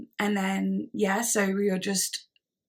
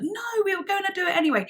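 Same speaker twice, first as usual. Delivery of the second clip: insistent reassurance; 12 semitones higher, faster than usual. Retold or imitated. Acting out friend's insistent reassurance.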